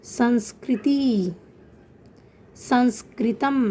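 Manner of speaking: संस्कृति, संस्कृतम slow, with an audible nasal "n"; nasal anusvara. Shown as a demonstration. Teacher demonstrating anusvara to pupils, संस्कृति, संस्कृतम slow and nasal on the "n". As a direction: draw out संस्कृति, संस्कृतम, sounding the "n" through the nose. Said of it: "न की sound आप सुनाई दे रही".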